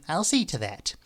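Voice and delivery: usual speaking voice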